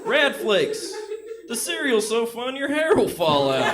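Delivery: ad tagline. Acted. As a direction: punch the slogan like an ad. Ad closing tagline.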